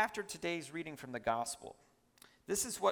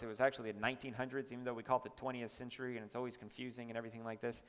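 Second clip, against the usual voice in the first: fast, low, softer tangent; rushed aside; 6 semitones lower, 6 dB quieter, much faster than usual. Real, not acted. Preacher explaining confusing century names to the congregation, rushed aside. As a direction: rattle it off quickly under the main point.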